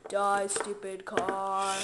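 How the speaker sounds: menacing. Pretend. Fighting the cart.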